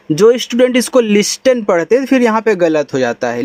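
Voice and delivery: ordinary delivery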